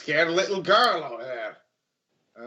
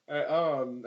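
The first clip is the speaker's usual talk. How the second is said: ordinary talk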